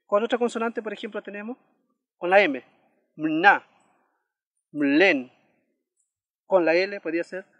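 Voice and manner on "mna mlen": mna, mlen loud, sixth vowel absorbed into a hummed M; vowel after consonant. Shown as a demonstration. Teacher gives examples with M, loud careful mna, mlen. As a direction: model mna, mlen separately, weight on the ending. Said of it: "pronuncia este sonido".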